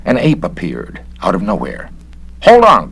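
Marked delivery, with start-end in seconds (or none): 2.4-2.9 high loud startled cry; frightened cry. Acted. Inspector startled by the ape, crying out high and loud. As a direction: jump back from the ape. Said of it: "cried Garrett".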